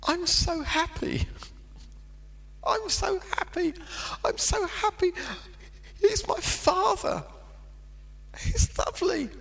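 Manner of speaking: very high, airy, overjoyed inner voice; retold rapture. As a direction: replay the giddy joy of a boy overwhelmed. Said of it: "And I'm thinking".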